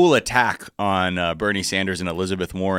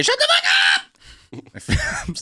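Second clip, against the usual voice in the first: shouted; much slower than usual. Acted. Sound drop clip.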